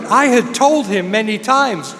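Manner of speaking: usual speaking voice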